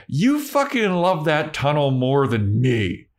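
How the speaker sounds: high, drawn-out mock whine; jealous outburst. Imitating Harmony quarrelling with Spike, jealous high complaint. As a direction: wail the accusation slowly and indignantly.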